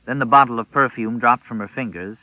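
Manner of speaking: ordinary delivery, nothing marked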